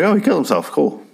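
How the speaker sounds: mock cheerful shrug at a suicide; flippant mock relief. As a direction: toss it off like it's good news. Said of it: "And I was like".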